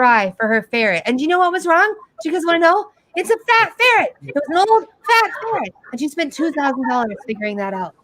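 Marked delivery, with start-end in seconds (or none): 3.2-5.7 high, gleeful reveal; spring the punchline with glee; higher, delighted reveal of the joke. Real, not acted.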